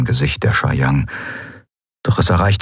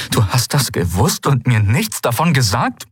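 higher, sharply swinging pitch; accusing; 6 semitones higher than usual. A narrator's reading. Kai confronting Ailan over the secret, accusing. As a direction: accuse with disbelief, hitting key words.

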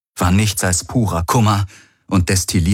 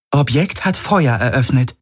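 Schiffsmeldung; 6 semitones higher than usual. A narrator's reading. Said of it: "meldete das Schiff".

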